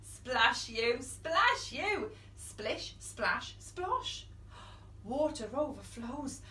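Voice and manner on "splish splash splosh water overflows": usual speaking voice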